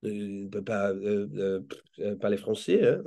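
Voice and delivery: a lightly voiced French comedy character, puzzled; Marcel's French. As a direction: retell Marcel rambling in French at the confused kids. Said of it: "I tapped the mic always and go".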